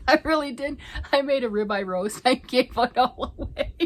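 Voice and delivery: high, loud, through real laughter; laughing confession. Real, not acted. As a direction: confess it while cracking up. Confessing while laughing at herself.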